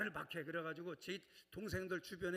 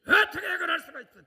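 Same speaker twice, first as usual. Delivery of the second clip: much higher, much louder, laughing mock outrage on 어떻게; indignant outburst; 10 semitones higher, 19 dB louder than usual. Shown as a demonstration. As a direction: act out a shocked, accusing church member. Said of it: "또 이러고 따지지 말고". (웃음 섞인 과장된 분노의 외침)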